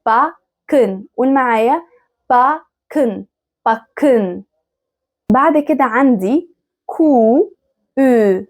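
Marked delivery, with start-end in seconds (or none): 0.1-0.9 Backen split around a heavy K; doubled k. Shown as a demonstration. Teacher drilling ck before learners repeat, doubled K in Backen. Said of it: "بيتنطقوا كأنهم كاف مشددة". 2.3-4.4 Backen with a held K in the middle; doubled k. Shown as a demonstration. Teacher asking learners to say it along, doubled K in Backen. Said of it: "بيتنطقوا كأنهم كاف مشددة".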